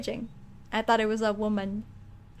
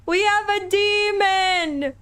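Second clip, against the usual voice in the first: high, loud, slow announcement; shocked exclamation; 7 semitones higher, 5 dB louder, much slower than usual. Real, not acted. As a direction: cry it out, every word stretched. Exclaims at discovering a demon.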